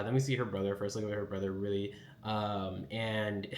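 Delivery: ordinary talk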